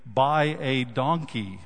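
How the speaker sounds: slow, deliberate "Buy a donkey"; spaced-out words, each one given weight; slowed down, changed emphasis. Shown as a demonstration. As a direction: draw out "Buy a donkey" word by word. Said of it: "slow that down and, and change the emphasis".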